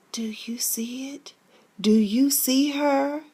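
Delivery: slow whisper easing into hushed voice; hushed, spooked. Retold or imitated. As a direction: imitate a spooked wife, slowly whispering. Frightened wife pointing out apparition.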